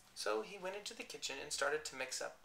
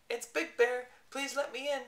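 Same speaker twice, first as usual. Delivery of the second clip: high, small squeaky bear voice; tiny voice; 9 semitones higher, 5 dB louder than usual. Acted. Shrunk bear at his door, tiny high plea. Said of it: "in a tiny voice".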